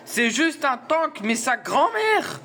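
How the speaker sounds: high, slow, cracking voice. Real, not acted. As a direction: let the voice crack as it goes. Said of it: "ma voix est en train de partir en couille".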